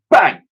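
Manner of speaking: sharp loud bang. A narrator's reading. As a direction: punch the bang out. Narrator voices bang.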